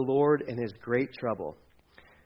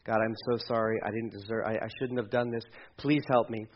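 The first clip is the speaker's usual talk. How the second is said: low, subdued plea to God; softly voiced remorseful begging; pleading prayer; 5 semitones lower, 7 dB quieter than usual. Jonah praying to God while overboard, low remorseful plea. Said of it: "he is overboard going".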